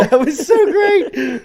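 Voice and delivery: drawn-out, high laughing praise; giggling delight, slow and high. Real, not acted. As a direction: laugh the words out slowly. Laughing with co-hosts.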